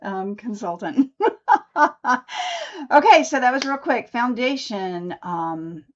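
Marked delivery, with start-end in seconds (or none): 1.2-2.9 high laugh. Real, not acted. Laughs at own sales pitch.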